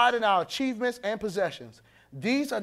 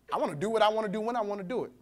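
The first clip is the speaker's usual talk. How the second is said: mock selfish rush; 2 semitones lower, 5 dB quieter, much faster than usual. Voicing Satan's selfish attitude.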